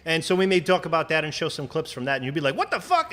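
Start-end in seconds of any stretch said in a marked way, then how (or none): 2.6-3.1 sudden high shocked "what the"; mock shocked reaction. Podcaster voicing a viewer's stunned reaction, high and shocked. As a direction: play the stunned viewer, pitch jumping up.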